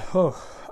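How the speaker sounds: long open-mouthed yawn. Real, not acted. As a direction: let out a real yawn. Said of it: "Excuse me as I yawn".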